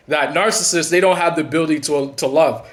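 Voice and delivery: normal talking voice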